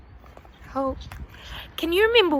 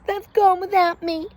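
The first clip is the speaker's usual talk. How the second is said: mild crying voice; 7 semitones higher, 3 dB louder than usual. A narrator's reading. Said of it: "And he cried".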